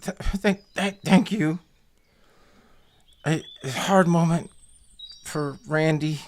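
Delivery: high, halting, choked-up goblin; croaking goblin. Acted. Crying goblin thanks the one hugging him, halting high croak. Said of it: "croaks and he goes".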